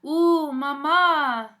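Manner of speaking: loud, slow, squealing coo; twittering. Acted. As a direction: swoon over the news like giddy girls. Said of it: "Valentina and Dulce twittered".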